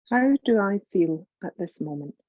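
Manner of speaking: usual speaking voice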